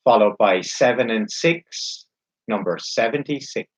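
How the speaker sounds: slow, drawn-out bingo calling; bingo caller. Acted. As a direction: call each number slowly, then repeat it.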